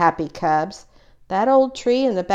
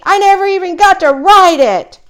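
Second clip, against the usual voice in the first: much higher and louder, near a tantrum; angry wail; 13 semitones higher, 10 dB louder than usual. A narrator's reading. Brother wailing to everyone about the unridden bike. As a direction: cry out the unfairness. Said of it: "stamping his feet".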